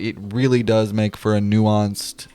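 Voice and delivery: ordinary talk, nothing marked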